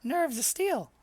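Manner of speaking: high, slow impressed start; admiring innkeeper. Acted. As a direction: praise him slowly, amazed.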